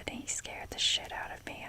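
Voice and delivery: ordinary talk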